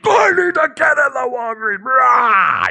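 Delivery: high, strained screaming outburst; freaking-out yell. Retold or imitated. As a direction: explode into a high-pitched enraged shriek. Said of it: "He just freaks out".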